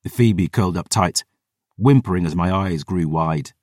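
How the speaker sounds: ordinary delivery, nothing marked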